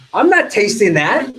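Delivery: high, loud, drawn-out refusal; mock disgusted refusal. Friend refusing to taste gross food, loudly disgusted. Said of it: "they make what kind of face?".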